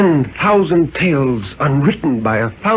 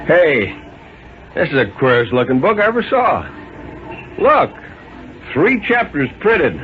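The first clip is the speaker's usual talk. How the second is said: puzzled officer; 4 semitones higher than usual. Acted. The policeman showing the crowd the book, higher and amazed. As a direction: marvel at the strange book.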